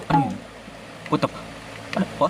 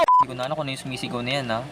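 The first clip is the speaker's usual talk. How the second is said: ordinary talk, nothing marked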